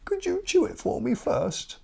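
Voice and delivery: very high little bird voice; tiny high bird asking a favour; bird character voice. Acted. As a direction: ask sweetly, very high. Bird asks crocodile.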